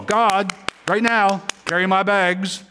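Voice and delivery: high, bossy order to God as a bellhop; mock demanding prayer. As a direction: parody an impatient believer bossing God.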